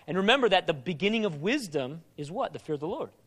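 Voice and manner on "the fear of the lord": ordinary talk, nothing marked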